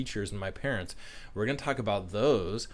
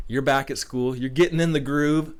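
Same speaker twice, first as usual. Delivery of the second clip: excited; 4 semitones higher, 8 dB louder than usual. Real, not acted. Pepping up students at school.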